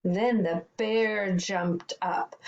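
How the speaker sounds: ordinary delivery, nothing marked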